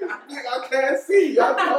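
high laughing protest. Real, not acted. Host laughing he can't see the ring, high.

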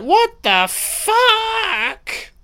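a squeaky, very high mock Freddy, drawn out slowly. Mocking Freddy as he spins round, in a drawn-out falsetto. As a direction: stretch it out in a silly falsetto as Freddy. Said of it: "Freddy's like".

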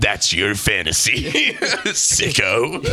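low, slow mock crime narrator; true crime narration. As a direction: accuse like a true crime host. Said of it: "like a true crime narration".

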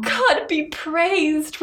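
very slow, high relieved praise; drawn-out joy. Acted. Reader voicing the wife slowly drawing out joyful relief. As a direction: savor the relief.

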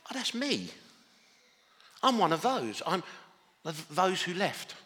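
as if it just dawned on you; sudden realisation. Retold or imitated. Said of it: "I suddenly thought".

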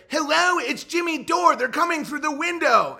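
high, hammed-up panicked phone call; panicked mock plea. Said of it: "desperately calling the police like".

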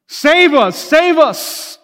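high, loud crowd cry; preacher voicing the crowd's high desperate shout. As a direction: cry out like the pleading crowd at Jerusalem. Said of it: "That's what they were shouting".

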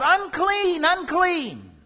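high, loud call of unclean, dragged out and falling; crying out a warning. Acted. Leper crying out to the crowd, a loud high warning call. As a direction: cry it out like a leper warning people off. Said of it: "cry out".

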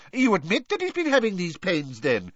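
quick, high-pitched pounce. A narrator's reading. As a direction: jump in quickly on the admission. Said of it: "said Fudge quickly".

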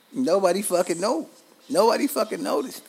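indignant, voice cracking high; incredulous high-pitched complaint; exasperated outburst. Real, not acted. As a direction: pitch jumps way up, nobody fucking noticed.

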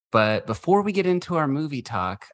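usual speaking voice

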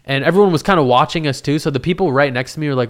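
normal talking voice

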